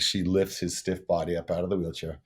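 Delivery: usual speaking voice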